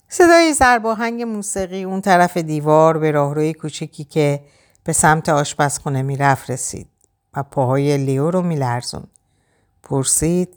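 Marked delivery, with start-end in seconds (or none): none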